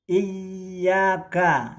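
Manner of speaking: iyyaka with the yy held and stressed; held doubled y. Shown as a demonstration. Teacher shows students the correct iyyaka, yy held. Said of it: "bukan iyyaka tapi iyyaka".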